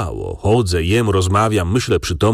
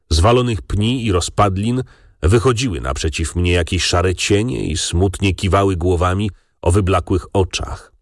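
usual speaking voice

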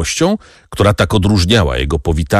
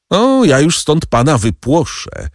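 a raised, higher cry, lightly voiced; mild shout; 9 semitones higher than usual. A narrator's reading. Albert threatening the count, a mild raised cry. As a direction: fling the threat upward without much volume. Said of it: "zawołał Albert".

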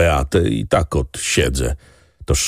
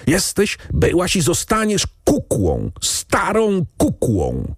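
much higher, slowing on "starą kukłą"; choking rage; 11 semitones higher than usual. A narrator's reading. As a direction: sputter out the insult in utmost fury. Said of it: "krztusił się w pasji najwyższej". (hrabia w furii obraża siostrę, krztusząc się)

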